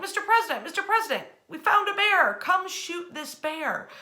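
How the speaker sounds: mock-excited hunters hailing their boss; eager hunters calling. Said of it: "They're like".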